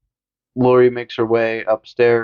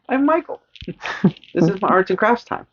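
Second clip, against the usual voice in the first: put-on much higher, little-kid voice; childish Michael; 9 semitones higher than usual. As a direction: play him as a proud kindergartner.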